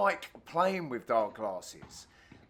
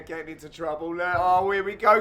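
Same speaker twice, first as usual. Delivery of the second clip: ordinary talk, nothing marked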